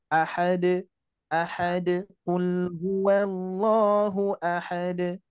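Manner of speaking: AHAD with the final d bounced at once; direct qalqalah. Shown as a demonstration. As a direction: chant it, releasing "ahad" straight into a bouncing d. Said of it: "coba dilangsungkan". ("ahad" dengan qalqalah langsung memantul)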